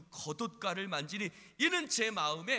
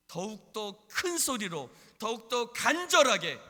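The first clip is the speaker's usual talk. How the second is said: building louder and higher, drawn out; preaching at full force; 7 semitones higher, 12 dB louder than usual. Real, not acted. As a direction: swell into a fervent proclamation. (점점 힘주어 외치는 설교조)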